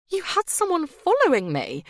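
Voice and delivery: stunned realisation thrown at him; overwhelmed at being followed. A narrator's reading. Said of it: "feeling overwhelmed".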